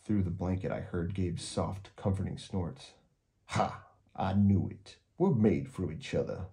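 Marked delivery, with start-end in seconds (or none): none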